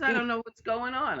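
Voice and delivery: high, bewildered exclaim; baffled. Retold or imitated. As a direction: throw up your hands, lost. Baffled TV man quoted.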